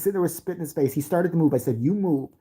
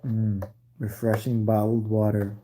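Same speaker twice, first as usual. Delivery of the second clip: mock commercial read; 5 semitones lower, slower than usual. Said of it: "I could do a commercial".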